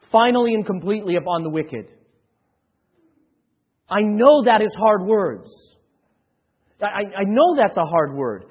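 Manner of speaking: loud, rising hellfire preaching; full-force preaching. Acted. Pastor warning the congregation of wrath, booming. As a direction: thunder the judgment over them.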